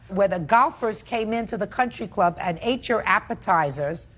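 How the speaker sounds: ordinary talk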